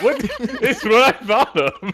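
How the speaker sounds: high, loud, slowed by helpless laughter; laughing protest. Real, not acted. Player teased about a word choice, protesting through laughter. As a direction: defend yourself while cracking up.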